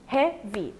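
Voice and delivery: "heavy" in two detached beats, hea, then vy; syllables separated. Shown as a demonstration. Teacher counting syllables for learners, "heavy" broken into two parts. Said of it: "two syllables".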